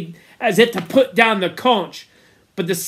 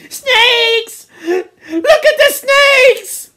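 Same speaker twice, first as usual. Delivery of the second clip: very high, drawn-out scream; terrified screaming; 8 dB louder, much slower than usual. A narrator's reading. Boys see creepers burning like snakes, screaming in terror. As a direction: shriek the warning in fright. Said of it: "the boys screamed at them".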